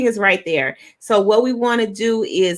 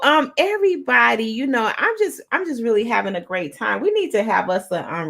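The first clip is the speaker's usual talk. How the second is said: ordinary talk, nothing marked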